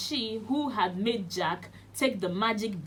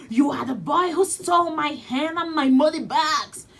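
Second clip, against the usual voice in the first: loud, higher, furious bellow; giant bellowing; 6 semitones higher, 8 dB louder than usual. Acted. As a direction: bellow the accusation at the thief. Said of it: "he bellowed".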